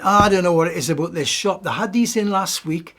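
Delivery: put-on loud, indignant grumbling old man. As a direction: complain loudly about the price like a fed-up pensioner. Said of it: "And he was saying".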